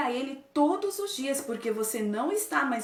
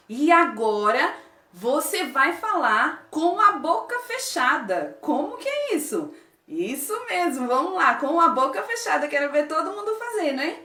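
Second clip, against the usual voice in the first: excited; 5 semitones higher, 5 dB louder than usual. Real, not acted. Teacher cheering kids on.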